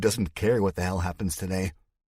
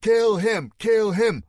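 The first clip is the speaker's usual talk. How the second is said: much higher, loud repeated cry; furious crowd member screaming for blood; bloodthirsty yell; 8 dB louder, slower than usual. Acted. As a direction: whip up the mob. Mob member calling for killing.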